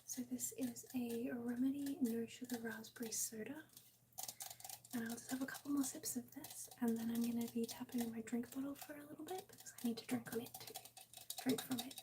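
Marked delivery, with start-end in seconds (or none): 0.1-10.4 soft ASMR whisper; breathy close whisper to chat. Real, not acted. ASMR stream whispering throughout.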